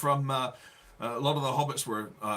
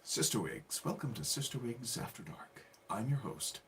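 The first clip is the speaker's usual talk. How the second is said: low, soft after-dark host voice; charming British host; 3 semitones lower, 10 dB quieter than usual. Pretend. Left alone to charm the viewers, mock late-night British host. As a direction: purr a hushed late-night British welcome. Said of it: "Be charming and British".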